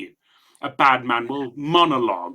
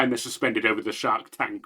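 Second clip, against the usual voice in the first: ordinary talk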